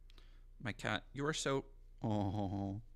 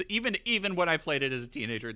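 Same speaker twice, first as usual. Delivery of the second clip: ordinary talk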